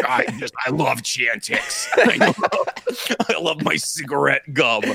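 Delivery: mocking dying-celebrity impression; slow, ailing voice breaking into laughter; Ray Liotta near death. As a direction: do a man a week from dying. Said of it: "You sounded like Ray Liotta, like, a week before he died".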